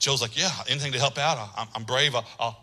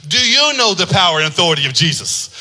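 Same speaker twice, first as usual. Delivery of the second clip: usual speaking voice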